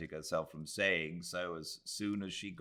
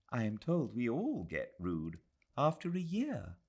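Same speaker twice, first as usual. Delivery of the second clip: put-on much higher, earnest young lady; a worried young woman thinking aloud; young Englishwoman; 6 semitones higher than usual. Adela confessing her fear to Aziz, earnest.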